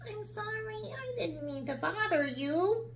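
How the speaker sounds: small high apologetic voice; timid girl. A narrator's reading. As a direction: apologize meekly. Sarah's voice.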